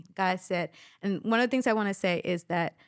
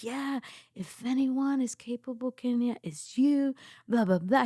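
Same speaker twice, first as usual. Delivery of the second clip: husband encouraging; 5 semitones higher than usual. Retold or imitated. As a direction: sell her on it, gently. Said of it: "And he was like".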